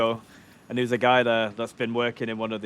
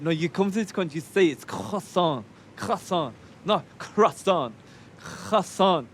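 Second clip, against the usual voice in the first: higher, insistent mock baker lecture; mock French rant; 7 semitones higher than usual. Retelling a French baker lecturing a customer on croissant, higher. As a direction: drill croissant into him, pitched up. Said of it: "he's just like".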